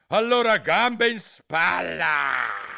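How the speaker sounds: very loud, slow, high roar; roaring queen. A narrator's reading. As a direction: roar the order at everyone. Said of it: "ruggì la regina".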